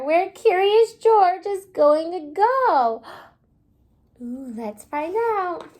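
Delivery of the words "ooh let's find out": soft gasp, then a quieter teasing "let's find out"; hushed excitement. Acted. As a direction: draw the children in with hushed curiosity. Teacher builds suspense for kids.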